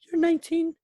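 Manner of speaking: squeaky high mock mother; high-pitched mom voice. Greg's mother doubting Tommy's age, squeaky and high. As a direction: pitch way up in disbelief. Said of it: "his mom's just like".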